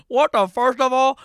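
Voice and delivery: high, loud mock-kid yell; hammed-up shouted imitation; mimicking catchphrase. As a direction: belt it out as a mock-bewildered kid. Mimics the clip kid's catchphrase.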